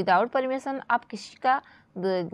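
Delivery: ordinary talk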